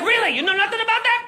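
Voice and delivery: hammed-up incredulous cop. Cop grilling again.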